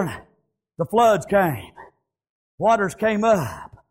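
ordinary delivery

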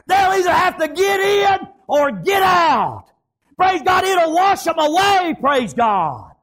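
high, driving revival preacher; high-pitched pulpit shouting; full-force preaching. Real, not acted. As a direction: preach it hard, pitched well up.

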